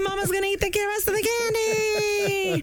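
high giddy cheer; gleeful. Pretend. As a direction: squeal with greedy glee. Mom gloating over candy.

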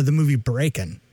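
ordinary talk, nothing marked

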